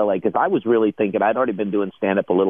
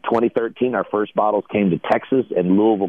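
usual speaking voice

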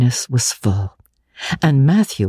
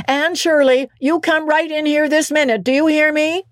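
much higher summons across the yard; calling out; 14 semitones higher than usual. Acted. Marilla calling Anne in from the woodpile, much higher. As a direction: call her in sharply.

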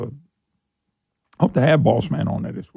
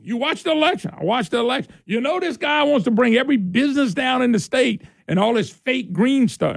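mock grilling; 10 semitones higher than usual. Imagined exchange with a politician.

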